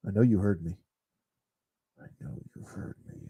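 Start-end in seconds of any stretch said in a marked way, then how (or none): 2.0-3.2 fast, hushed menacing whisper. Pretend. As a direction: breathe it like a creepy threat. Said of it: "I'm just kidding".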